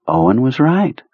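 plain reading voice

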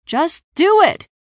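loud, high, drawn-out shouted order. A narrator's reading. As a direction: shout the command impatiently at a friend. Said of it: "I shouted".

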